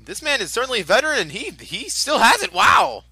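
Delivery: fast, high excited call; excited commentary. Real, not acted. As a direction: build up to a shout. Live commentary on veteran.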